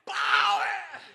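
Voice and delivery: a loud, high shouted "Power!"; mocking the fiery preachers with a loud shout. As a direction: blast it like a hammed-up fire preacher. Preacher mocks fiery prayer style.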